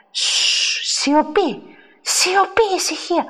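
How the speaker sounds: long shush, then high commands for silence; urgent hushing. Acted. Churchgoer silencing quarrelling women, urgent hushing. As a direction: hush them sharply, climbing in pitch.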